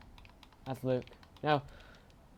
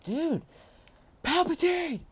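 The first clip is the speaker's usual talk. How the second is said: outraged yell; 9 dB louder, slower than usual. Real, not acted. Teammate steals his kill.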